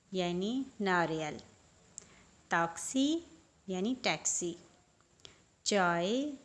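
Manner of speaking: normal talking voice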